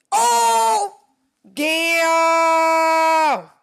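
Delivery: overjoyed screams at her own photo; real screaming. Real, not acted. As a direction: scream with pure delight. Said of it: "I'm yelling and screaming".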